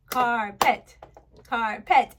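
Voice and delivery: "carpet" in two punched beats; syllable by syllable. Shown as a demonstration. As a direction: clap out "carpet", loud with a break between syllables. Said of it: "you would clap".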